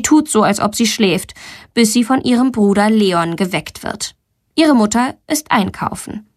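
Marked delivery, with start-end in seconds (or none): none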